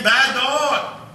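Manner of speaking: high, loud scolding shout. Retold or imitated. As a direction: snap at the dog. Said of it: "I scolded him again".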